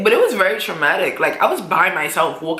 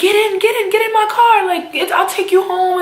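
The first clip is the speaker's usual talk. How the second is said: urgent offer; 12 semitones higher, faster than usual. Retold or imitated. Nurse urging the robbed girl into her car, high and urgent. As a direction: hurry the crying girl into the car.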